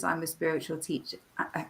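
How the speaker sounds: croaky throat, needs clearing; frog in throat. Real, not acted. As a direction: voice catching in the throat. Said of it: "with a frog in my throat".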